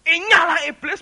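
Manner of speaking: loud, high command to depart; rebuking shout. Acted. Jesus driving the devil away, rebuking shout. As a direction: bellow it as an exorcising rebuke. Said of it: "Yesus itu nengkingnya dua".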